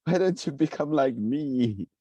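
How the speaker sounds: slow, exaggerated whine of frustration; mock indignant demand. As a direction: demand they become like you.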